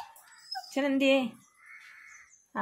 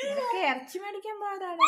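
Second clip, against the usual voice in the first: coaxing shout; 8 semitones higher than usual. Real, not acted. Calling over crying pet.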